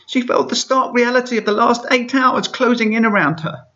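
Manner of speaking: squeaky high reading voice; perfect reading voice. Shown as a demonstration. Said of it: "I'll stop doing the voice now".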